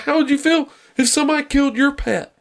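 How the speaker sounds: high-pitched mock upset kid; crying little boy. Little boy confronting a deer hunter, mock upset. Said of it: "the little boy's crying".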